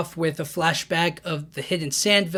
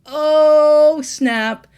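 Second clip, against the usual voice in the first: much higher, much louder gasp of surprise; mock shock; 10 semitones higher, 9 dB louder than usual. Recapping the chapter's ending, a much higher, louder stunned Oh snap. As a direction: play up your own shocked reaction. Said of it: "And I'm just like".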